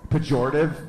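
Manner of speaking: normal talking voice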